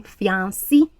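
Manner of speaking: fiancé said fee-AN-see; mistaken pronunciation. Shown as a demonstration. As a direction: demonstrate fiancé wrongly ending in "see". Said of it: "don't make the mistake of saying".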